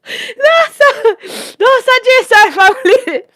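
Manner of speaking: high, tearful laughing squeal; helpless laughter. Real, not acted. Storyteller overcome by doctor story, helplessly laughing. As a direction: cry it out high, weak with laughter.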